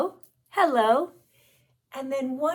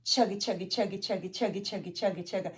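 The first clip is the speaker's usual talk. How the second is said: soft rhythmic chugga chant; train chugging; 4 semitones lower, 5 dB quieter, faster than usual. Shown as a demonstration. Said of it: "move our arms like this".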